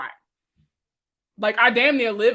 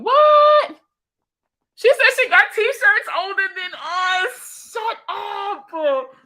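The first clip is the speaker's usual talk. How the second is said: shocked shout; 12 semitones higher, 7 dB louder, much slower than usual. Real, not acted. Hearing class of ninety-seven.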